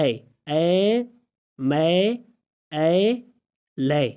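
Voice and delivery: اے، میں، اے، لے slowly drawn out on "ae"; isolated "ae" sound. Shown as a demonstration. Teacher showing which sound A gives, اے، میں، اے، لے long and separate. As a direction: stretch each vowel in اے، میں، اے، لے, pausing between. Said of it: "اے کیا آواز دے رہے ہیں".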